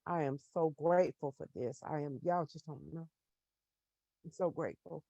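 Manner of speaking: fighting tears, barely above a murmur; real emotion, trying not to cry; holding back tears. Real, not acted. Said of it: "I'm trying not to cry".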